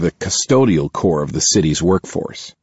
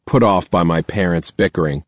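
usual speaking voice

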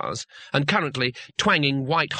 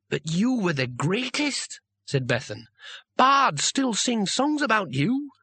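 put-on awed young girl; 8 semitones higher, slower than usual. Bethan marvels at the hero.

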